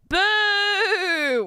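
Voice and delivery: long, high-pitched boo; high, drawn-out heckle at the game; disappointed jeer. Real, not acted. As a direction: draw out a boo of protest. Jeers at game not letting them cook.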